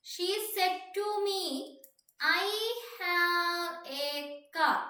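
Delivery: high, sustained, stretched-out words; slow dictation. Real, not acted. Teacher reading out the question as it is written, slow dictation. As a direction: spell out the sentence word by word, slowly.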